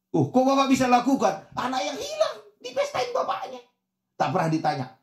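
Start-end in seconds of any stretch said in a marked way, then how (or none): 0.1-3.6 very high, astonished exclamation; incredulous child. Acted. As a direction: let disbelief push the voice up into a squeal. Child amazed at father's forgiveness. (heran tak percaya, suara melengking tinggi)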